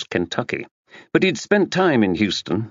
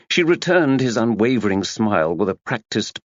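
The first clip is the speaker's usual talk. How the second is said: ordinary delivery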